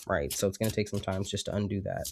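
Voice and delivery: usual speaking voice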